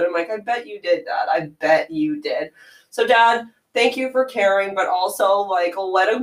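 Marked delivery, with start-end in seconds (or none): 0.3-1.3 dry knowing jab at dad; mildly sarcastic first take. Retold or imitated. 1.3-2.5 drawn out, lower and slower, knowing; lower, slow sarcastic echo; slower sarcastic repeat. Retold or imitated.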